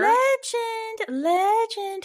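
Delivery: eerie high storyteller voice; ghostly legend voice; spooky. Acted. Spooky dramatic reading of legend.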